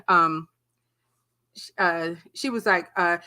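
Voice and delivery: ordinary talk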